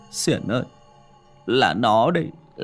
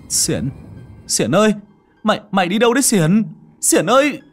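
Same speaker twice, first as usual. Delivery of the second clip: frantic high yell, calling a friend into the rain; desperate shouting; 12 semitones higher, 6 dB louder than usual. Acted. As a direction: scream his name after him, voice straining. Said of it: "gào đến khản giọng". (gào to thảng thốt gọi bạn)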